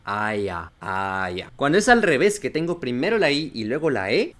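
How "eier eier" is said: "Eier" slow and low, as ai-a; pronunciación alemana. Shown as a demonstration. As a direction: say "Eier" with final a, no r. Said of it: "sería". ("Eier" en alemán, ai-a pausado)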